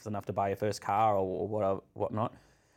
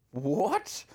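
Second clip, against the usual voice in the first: shocked what; 8 semitones higher than usual. Retold or imitated. Friends shocked at vomiting.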